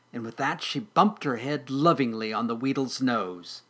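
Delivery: plain reading voice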